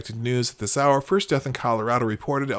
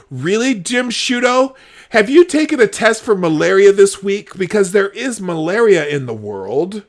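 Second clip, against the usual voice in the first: loud, raised-pitch sarcastic challenge; incredulous rant; 6 semitones higher, 8 dB louder than usual. Real, not acted. Host challenging a journalist about malaria tests, incredulous. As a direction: throw the absurd question at him, exasperated.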